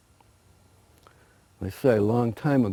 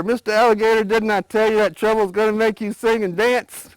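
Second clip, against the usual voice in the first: loud, higher taunting call; mocking shout; 11 semitones higher, 7 dB louder than usual. A narrator's reading. Rabbit shouting up at the burning alligator, taunting. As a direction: shout up the hill with a gloating jeer. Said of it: "that rabbit shouted up there".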